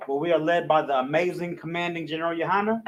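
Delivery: ordinary talk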